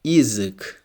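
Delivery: "язык" opening on ee, like ee-zyk; unstressed Ya as I. Shown as a demonstration. Teacher models an example word for learners, "язык" starting with ee. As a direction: say the first letter of "язык" as ee. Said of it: "so it's pronounced like E".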